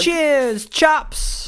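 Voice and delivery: hammed-up bad British accent, very high; squeaky mock British accent. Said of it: "It's not good, is it?".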